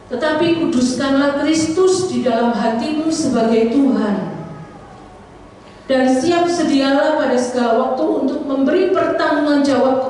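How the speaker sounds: loud, high, solemn Bible reading; scripture reading. Acted. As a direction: proclaim the verse to the whole church. Reads scripture aloud to congregation. (membaca ayat Alkitab dengan lantang)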